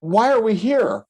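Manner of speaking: high, drawn-out exasperated question. Retold or imitated. Agent to buyer who has no money, exasperated. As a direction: stretch it out high in disbelief.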